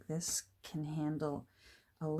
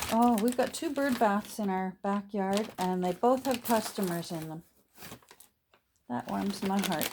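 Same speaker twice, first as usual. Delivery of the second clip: talking through a mouthful of candy; mouth full; 2 semitones higher, 4 dB louder than usual. Real, not acted. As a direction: speak around a candy in the mouth. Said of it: "sorry, my mouth is full".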